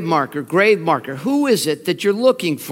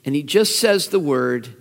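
ordinary talk, nothing marked

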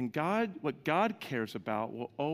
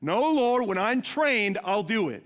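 excuse to God; 7 semitones higher, 7 dB louder than usual. Retold or imitated. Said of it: "Have you ever been there".

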